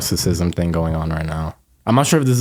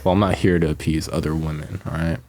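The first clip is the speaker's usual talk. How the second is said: intro the show in a sleepy low voice; morning voice; 3 semitones lower than usual. Pretend. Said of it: "should I start introing the podcast like that?".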